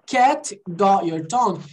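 slow, separated "cat got your tongue"; careful teacher's slow model; slow demo. Shown as a demonstration. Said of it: "quando eu falo devagar".

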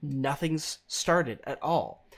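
ordinary talk

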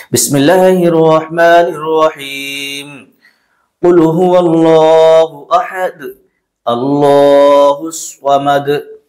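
slow, drawn-out chanted recitation; Quran recitation. Acted. Teacher recites the whole surah for students, slow tajweed chant. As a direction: recite the surah slowly, every letter clear.

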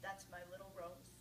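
lightly voiced proud father; mild gruff voice of her late father. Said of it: "said to everyone in your gruff voice".

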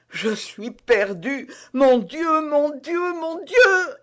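high anguished cry to God; a lost queen pleading with heaven, voice breaking upward; desperate queen. Acted. Reine seule, perdue, prie. (reine éperdue, voix aiguë et suppliante)

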